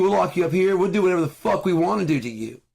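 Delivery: loud, high-pitched threatening rant; angry threat. Acted. Acting out military threatening him.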